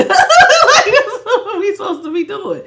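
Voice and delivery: very high voice through laughter; helpless, high-pitched laughing speech; laughing hard. Real, not acted. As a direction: squeal the words out while cracking up. Cracks up mid-explanation.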